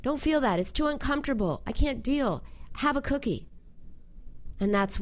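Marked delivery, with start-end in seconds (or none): 0.0-3.5 higher, hurried nervous inner voice; a high, fretful voice urging a cookie; anxious inner voice. Retold or imitated. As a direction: panicky part of you pushing the feeling away. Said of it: "Another part of you goes".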